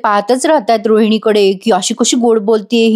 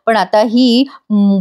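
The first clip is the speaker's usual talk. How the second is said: normal talking voice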